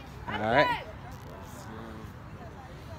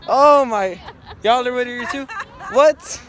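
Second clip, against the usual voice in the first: rising, louder disbelief; surprised; 5 semitones higher, 4 dB louder, slower than usual. Real, not acted. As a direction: react with loud disbelief. Surprised at rival fans.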